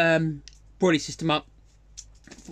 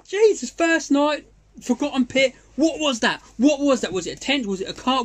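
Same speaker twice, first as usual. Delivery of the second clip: retold excitement; 6 semitones higher than usual. Retelling startled bite alarm at night.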